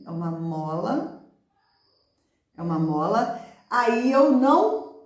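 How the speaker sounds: ordinary talk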